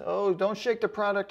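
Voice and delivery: ordinary delivery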